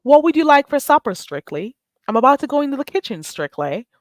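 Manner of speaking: playful put-on English accent. Shown as a demonstration. Host asking Strictly about supper, English accent. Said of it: "So that was my English accent".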